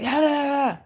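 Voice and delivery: high-pitched gibberish imitation of ranting; mocking rant. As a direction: mimic someone's yammering up high. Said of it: "And she was like".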